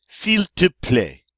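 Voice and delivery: slow model of "S'il te plaît"; careful French. Shown as a demonstration. Teacher stressing the right sound of "S'il te plaît". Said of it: "if you say it correctly".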